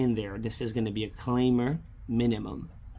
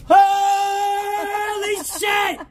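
screaming then laughing; a shriek that breaks into laughter; scream into giggles; 5 dB louder, much slower than usual. Real, not acted. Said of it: "You scared me".

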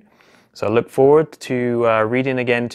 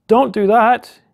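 high, louder squeaky frog plea; frogs' voice; 11 semitones higher, 5 dB louder, slower than usual. Acted. Frogs in the pond warning Mister Fox, high squeaky plea. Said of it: "But the frogs down in the corner here says".

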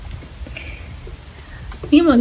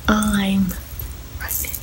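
barely audible confession; 2 semitones lower, 8 dB quieter, slower than usual. Retold or imitated. Retold confession to dad.

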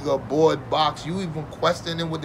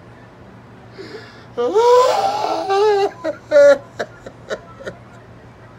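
laugh-cry wail; 14 semitones higher, 8 dB louder than usual. Acted. Laughing into mock crying.

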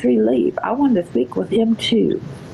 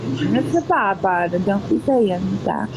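ordinary talk

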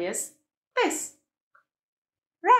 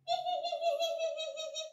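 high, soft little giggle; baby giggle; 9 dB quieter than usual. Pretend. Happy baby after being fed, high soft giggle.

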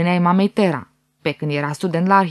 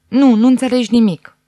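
louder, cutting contradiction; intense restrained anger; 4 semitones higher, 5 dB louder than usual. A narrator's reading. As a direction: cut him off with cold fury. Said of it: "tonul lui era mult mai intens".

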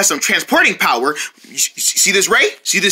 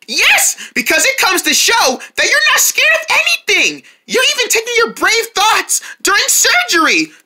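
much higher, gushing and eager; high excited voice; 9 semitones higher than usual. Acted. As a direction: squeal it out with excitement.

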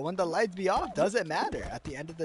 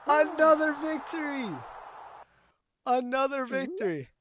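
loud high cheer that winds down; victory cheer fading; 11 semitones higher, 7 dB louder than usual. Real, not acted. Streamer celebrating a win to chat, fading cheer. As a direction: crow over the win, then settle.

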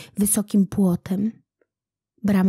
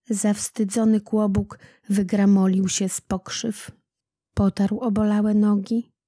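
plain reading voice

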